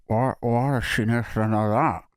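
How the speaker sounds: muffled, garbled imitation; sore-throat speech. Missus questioning admin lady's question, muffled by sore throat. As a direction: mumble it as if the throat is swollen. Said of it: "she still can't drink or eat or talk".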